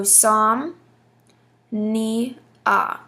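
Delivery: "som, ni, a" in three separated syllables; "som, ni, a" measured out piece by piece. Shown as a demonstration. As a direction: break "som, ni, a" apart with a pause after each syllable. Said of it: "that's gonna be a dactyl".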